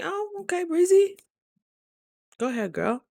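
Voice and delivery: put-on squealed high, like cheering on a girlfriend; playful high-pitched self-hype. Hyping herself up at gas station.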